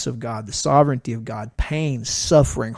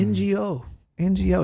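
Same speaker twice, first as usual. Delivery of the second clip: higher, insistent repeat; mildly exasperated, raised pitch; retold irritation; 6 semitones higher than usual. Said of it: "So I'm getting irritated".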